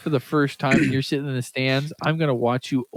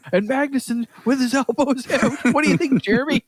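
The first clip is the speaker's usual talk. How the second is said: mock commentator; 10 semitones higher than usual.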